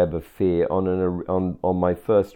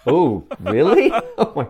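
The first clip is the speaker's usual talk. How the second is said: laughing disbelief; 5 semitones higher, 4 dB louder than usual. Real, not acted.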